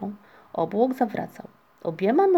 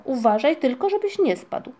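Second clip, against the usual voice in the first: raised warning; 5 semitones higher, 4 dB louder than usual. A narrator's reading. Busy mother warns flying child.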